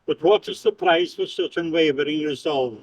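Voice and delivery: ordinary talk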